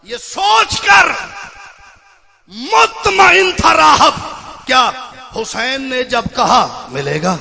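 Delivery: high, loud, very drawn-out verse declamation; poem. Real, not acted. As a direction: cry the couplet out slowly with pauses. (खींच कर बुलंद आवाज़ में शेर)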